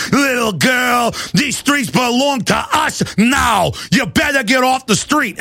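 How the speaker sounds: slow, menacing, rising to a shout; the movie heavy claiming the streets; menacing movie thug warns a street-team girl, shouting. Retold or imitated. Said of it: "like in the movie".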